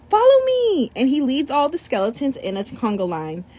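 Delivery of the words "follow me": high, loud call; excited boy calling. Acted. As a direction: lead the line with a cheerful shout. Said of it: "Beto calls out".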